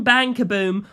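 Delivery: ordinary delivery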